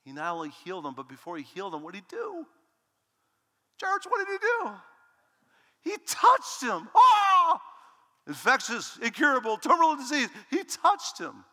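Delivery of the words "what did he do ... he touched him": much higher, louder preaching shout; preaching at full force. Acted. Preacher calling to his church, full-force shouting. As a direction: drive it home to the church, ringing out.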